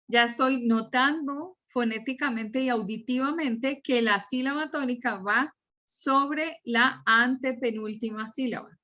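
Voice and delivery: usual speaking voice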